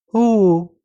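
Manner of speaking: put-on high, loud outraged toddler whine; indignant baby wail. A narrator's reading. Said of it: "возмутилась малышка". (возмущённый детский вой)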